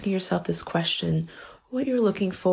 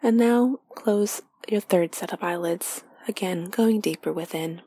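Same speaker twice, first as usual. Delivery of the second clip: guided meditation; 2 semitones higher than usual. Acted. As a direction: lead them inward gently, half whispered.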